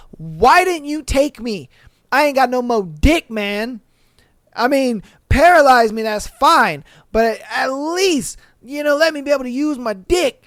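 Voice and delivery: mock-plead at the injustice of it; imitated anguished lament. Injured man asking Jesus why he was spared, mock-anguished. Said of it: "I bet you he was like".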